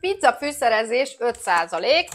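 possibly small bites between words; snacking while talking. Real, not acted. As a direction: puff held at lips. Seen 0: Holds puff at lips while talking; actual chewing unclear.

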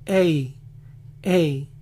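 "ey" as an English gliding vowel; "ey" sliding from e into i; English final stressed E. Shown as a demonstration. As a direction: say "ey" like the letter A in English. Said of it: "terminan diciendo".

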